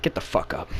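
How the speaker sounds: low, quiet command; Sonic. Acted. Sonic standing over crying Tails, low quiet order. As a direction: order it coldly under your breath. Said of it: "Sonic's like".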